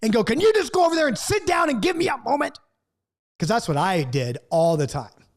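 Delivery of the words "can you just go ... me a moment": high, exasperated shouted demand; imitated blow-up. Dad cooking breakfast snaps at kid, mock exasperated shout. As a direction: snap at the kid in a raised, high voice. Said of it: "he was ready to blow up at the kid".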